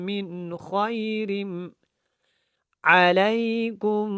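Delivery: very slow chant, long nasal hold ending خير; measured Quran recitation with merged, held consonants; tajweed recitation. Shown as a demonstration. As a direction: clear n in من, then hum into ربكم. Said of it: "خیرم کے اوپر ہم نے غنہ کرنا ہے".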